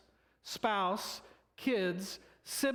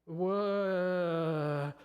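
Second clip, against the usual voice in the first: a drawn-out, startled whoa; mock shocked disciples; stunned whoa. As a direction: gasp it out like the news just hit. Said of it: "They're like".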